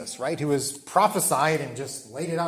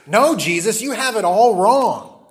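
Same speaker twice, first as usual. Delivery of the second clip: loud, high "No, Jesus" with "all wrong" drawn out; emphatic contradiction, "all wrong" stretched and raised; 6 semitones higher, 7 dB louder than usual. Acted. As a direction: push "you have it all wrong" out high, loud and slow. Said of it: "he said emphatically".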